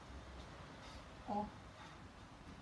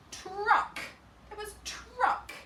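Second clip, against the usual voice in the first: remembering aloud; 12 semitones higher, 6 dB louder, much slower than usual. Shown as a demonstration. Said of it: "Let me think about that".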